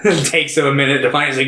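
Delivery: ordinary talk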